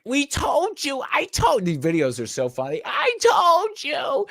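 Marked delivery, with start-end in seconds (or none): 0.0-1.6 much higher mock voice, gloating; mocking whiny voice. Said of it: "They're like". 2.8-4.3 much higher, slow drawn-out gloating; mocking whiny voice. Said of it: "They're like".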